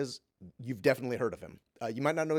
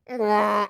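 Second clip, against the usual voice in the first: a high, loud mock jealous honk; 9 semitones higher, 11 dB louder than usual. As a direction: imitate a bird squawking jealously. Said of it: "this honk sound that kinda sounds jealous".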